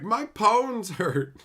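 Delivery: high, loud, drawn-out mock complaint; mock whine. Streamer joking as a wizard of ponies, mock wailing. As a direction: wail it slowly, hammed up. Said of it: "I just be like".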